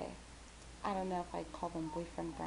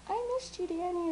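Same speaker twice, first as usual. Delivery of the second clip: sweet greeting; 12 semitones higher than usual. Retold or imitated. Friends greeting her online.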